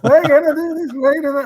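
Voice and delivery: hammed-up jubilant coach, voice shooting way up; overjoyed coach. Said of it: "So then he's gone".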